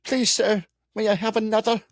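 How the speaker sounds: put-on high, pleading English child voice; piping little-kid English accent; little British kid. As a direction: beg like a little English urchin. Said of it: "like a little kid pop-up going".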